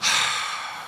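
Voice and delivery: imitated long, weary sigh; wife's sigh. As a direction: heave a big impatient sigh. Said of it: "She does one of these".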